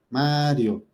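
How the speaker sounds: "Mario" with a drawn-out A, then falling; stress on the A. Shown as a demonstration. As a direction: lengthen the first syllable, MAAA-rio. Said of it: "la fuerza de la voz está cayendo en la A".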